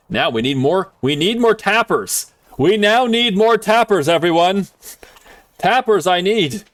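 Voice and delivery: calling out. Real, not acted. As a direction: shout it out to the whole chat.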